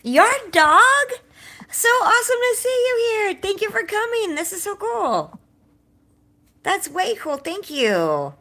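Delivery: high, loud, gushing hello to guests; excited greeting. Real, not acted. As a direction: squeal a delighted welcome at the door. Hostess greeting arriving guests.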